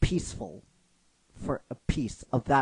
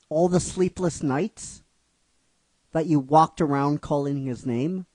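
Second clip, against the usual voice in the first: higher, louder pleading challenge; sister confronting Misty; 4 semitones higher, 5 dB louder than usual. Retold or imitated. Sister confronting Misty about sleepless nights, raised and insistent. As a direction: push her to admit it, voice raised. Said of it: "one sister says to her".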